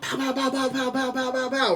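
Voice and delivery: high, soft mock gunfire pops; playful imitated blaster fire; gunshot noises. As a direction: spit out pow pow like a toy gun. Imitating gunfire in action retelling.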